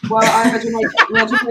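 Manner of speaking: laughing high and loud. Real, not acted. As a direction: laugh through the words. Laughing about accent.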